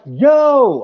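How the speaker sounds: high, loud holler; hollering at the top of voice. Retold or imitated. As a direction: yell it as loud as you can. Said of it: "I hollered to the top of my voice".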